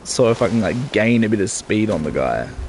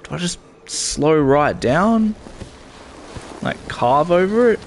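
exasperated; 8 semitones higher than usual. Real, not acted. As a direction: shout in disbelief at the game. Frustrated at game.

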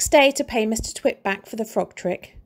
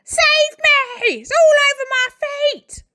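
panicked screaming; 6 dB louder than usual. A narrator's reading. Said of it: "screamed Missus Twit".

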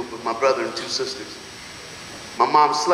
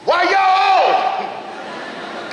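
put-on kid yelling to the front seat; much slower than usual. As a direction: shout it from the back seat like a cheeky eight-year-old. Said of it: "I yell to the front seat".

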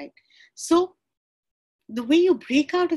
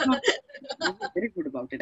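quick words through a laugh; 2 semitones higher, faster than usual. Real, not acted. Reassuring the call she avoided elevators, laughing. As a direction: brush it off, laughing.

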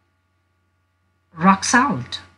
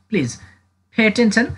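ordinary talk, nothing marked

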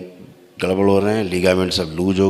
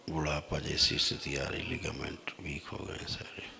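hushed trance voice; 3 semitones lower, 21 dB quieter than usual. Acted. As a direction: murmur each ailment as if sensing it. Guru divining the visitor's ailments.

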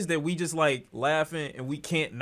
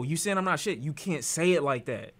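usual speaking voice

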